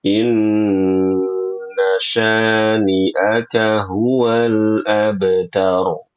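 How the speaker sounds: very slow chant of the verse; slow model recitation. Shown as a demonstration. Teacher modelling the verse to repeat. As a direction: recite it slowly for the student to copy. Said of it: "Ulangi lagi".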